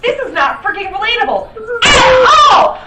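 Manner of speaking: loud, high furious rant; angry shout. Real, not acted. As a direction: shout it out in outrage. Ranting about the show.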